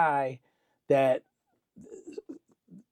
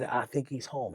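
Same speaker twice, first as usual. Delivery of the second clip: low and soft, imitating a private answer; hushed reply; 4 semitones lower, 10 dB quieter than usual. Friend privately answers Fifty about Mark, hushed. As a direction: keep the retold reply under your voice.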